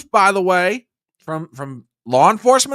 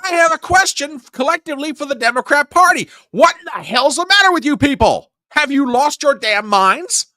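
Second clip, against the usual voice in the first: exasperated rant; 7 semitones higher than usual. Real, not acted. Ranting at Democrat Party.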